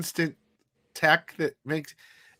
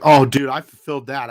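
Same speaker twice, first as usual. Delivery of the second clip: loud self-quote; 9 dB louder than usual. Retold or imitated. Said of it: "I'm like".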